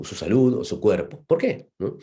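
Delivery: ordinary talk, nothing marked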